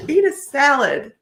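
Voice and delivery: giggling while repeating it; laughing. Real, not acted. Repeating the card's punchline to viewers while laughing.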